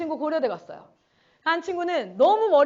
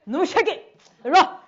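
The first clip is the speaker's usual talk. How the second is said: louder, a playful mock threat to a delinquent kid; mock threatening; 5 dB louder than usual. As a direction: bark "일로 와" like a tough teacher half in jest. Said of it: "웬만하면 일단 협박하고 시작해야". (장난스럽게 위협하는 말투)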